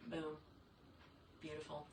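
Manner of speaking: ordinary delivery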